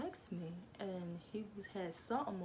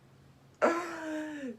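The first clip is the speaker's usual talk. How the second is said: big burst of laughter; loud laugh; 9 semitones higher, 8 dB louder than usual. Real, not acted. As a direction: laugh hard at the joke. Laughs at the prank reveal.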